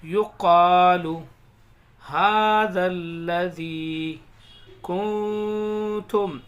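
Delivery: slow, loud, drawn-out tajweed recitation; measured recitation of verses; Quran recitation. Shown as a demonstration. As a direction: chant each word slowly with long pauses. Recitation continues.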